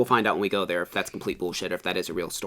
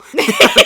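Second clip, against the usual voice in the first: high, loud burst of laughter; 13 dB louder than usual. Real, not acted. Host cracks up while mocking the skeptic, loud high laugh. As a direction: break up laughing at your own joke.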